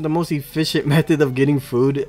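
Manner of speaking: loud, energetic recap voiceover; voiceover narration. Acted.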